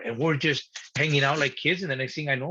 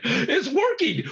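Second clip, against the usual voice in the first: much higher and slow, laughing as he says it; giddy disbelief; 12 semitones higher, slower than usual. Retold or imitated. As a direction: laugh out the surprise of winning. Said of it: "I'm like".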